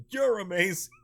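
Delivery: high, loud shout of praise to an idol; loud, high-pitched gushing, half laughing. Acted. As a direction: shout it like a thrilled fan. Fan calling out to the actor.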